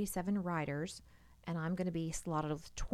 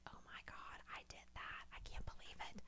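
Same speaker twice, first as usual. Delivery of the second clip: softer, hushed disbelief; awed whisper; 15 dB quieter than usual. Retold or imitated. Rider recalls finishing twenty-four miles, awed hush. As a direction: breathe it out in amazement, softer. Retold self-amazement after long ride.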